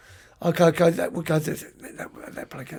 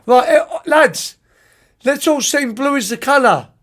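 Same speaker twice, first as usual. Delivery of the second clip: put-on drunk rallying call; 5 semitones higher, 3 dB louder than usual. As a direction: call the whole bus to sing like a merry drunk. Drunk coach rallying the bus.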